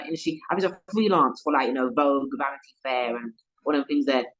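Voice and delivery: ordinary talk, nothing marked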